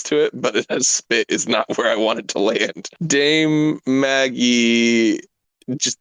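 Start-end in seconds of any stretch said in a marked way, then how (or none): 3.1-5.2 very slow stately grand dame. Acted. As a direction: stretch it out like royalty. Grand self-introduction.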